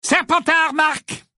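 high, slow stadium call; goal announcement. A narrator's reading. Lee announcing a Slytherin goal over the crowd, calling out. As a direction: announce it over cheers and boos. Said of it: "annonça la voix de Lee".

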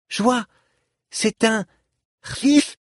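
put-on high-pitched excited child voice; very high little voice, thrilled by a discovery. A narrator's reading. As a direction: pipe up like an eager child. Child pointing out an instrument.

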